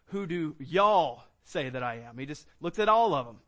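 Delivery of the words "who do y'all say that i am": slow, drawn-out Southern "y'all" in the question. Shown as a demonstration. Preacher giving the verse in Southern speech, drawling y'all. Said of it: "So that in the South, we would say".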